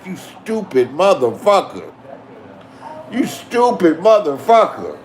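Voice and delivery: slow, drawn-out scornful insult; mock roast. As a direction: drag it out like taunting a fool. Retold roasting Will on stream.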